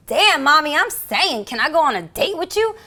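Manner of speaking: high, loud come-on, imitated; flirtatious suspect. Arrested man asking the cop for a date, flirting. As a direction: play an eager, pleading catcaller.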